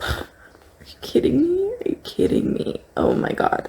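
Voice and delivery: breathless, awed whisper after a sigh; moved whisper. Real, not acted. Reader stunned by a passage on grief, whispering. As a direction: whisper it, overwhelmed by the line.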